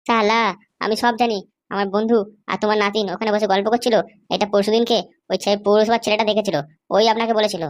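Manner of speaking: low, mocking thug voice; young thug. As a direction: taunt the old man from deep in the chest. Thug keeps taunting. (নিচু গলায় ঠাট্টা করা গুন্ডা)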